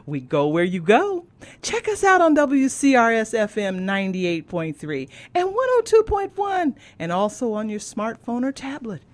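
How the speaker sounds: loud, punchy announcer plug, easing for details; radio station promo. Real, not acted. Host sending listeners to the break, announcer style. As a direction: sell the station with big swings in pitch.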